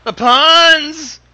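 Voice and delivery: loud, exasperated cry of puns; loud complaint about the puns; loud groan at puns. Real, not acted. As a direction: groan loudly at the pun. Repeats complaint louder.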